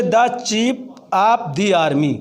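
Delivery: first "the" said "daa", second "the" said "dee"; slowly, "daa" in "the chief", "dee" in "the army"; THE as daa, then dee. Shown as a demonstration. Teacher giving an example, "the" as "daa" before chief, "dee" before army. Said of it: "तो उसे दा पढ़ते हैं".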